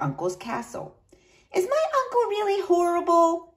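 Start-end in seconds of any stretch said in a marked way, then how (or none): 1.5-3.5 put-on high, small girlish voice; worried little princess. A narrator's reading. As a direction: ask like an anxious little girl. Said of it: "she asked Friar Tim".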